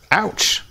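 sharp high yelp of pain. Acted. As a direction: yelp as the slap lands. Slapped man cries out.

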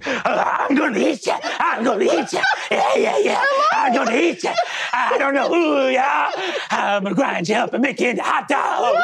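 high, shouted cartoon villain threats; hammed-up maniac, climbing to a shriek; manic menacing voice. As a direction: holler like a crazed monster about to eat them. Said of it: "You hear a voice go".

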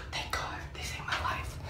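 breathy whispered aside; hushed whisper of relief to yourself; relieved whisper. Retold or imitated. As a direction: whisper it like a secret thank-you. Relief as friends walk in.

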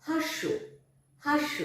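হাস্য said as "hashsho", with a doubled sh; clear pronunciation demo. Shown as a demonstration. Teacher demoing the word to pupils, careful and clear. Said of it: "দন্ত সয় জফলা".

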